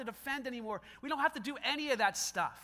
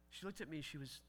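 ordinary talk

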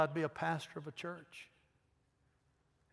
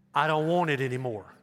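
loud, emphatic declaration; emphatic, stretched-out refusal; forceful renunciation; 8 dB louder than usual. Acted. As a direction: renounce it firmly with full voice. Voicing forceful renunciation of sin.

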